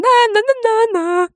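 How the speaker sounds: high teasing na-na-na, mocking the idea; mocking singsong na na na. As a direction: sing it out like a taunt. Spöttisches Abwinken, stark erhöht. (hoch und spöttisch wie ein Kinderreim)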